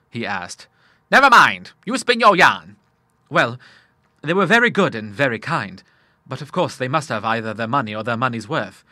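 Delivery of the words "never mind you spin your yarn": louder, higher bluff reply; old father urges his son on loudly; hearty dismissal. A narrator's reading. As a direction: wave the worry away. Admiral brushing off worry.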